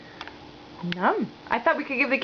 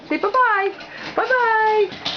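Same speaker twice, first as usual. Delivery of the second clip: put-on higher sing-song "bye-bye" modelled for a toddler; 8 semitones higher, slower than usual. Shown as a demonstration. Parent models "bye-bye" for the toddler to copy, high sing-song. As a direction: chirp "bye-bye" high so she copies it. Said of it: "Can you say bye-bye?".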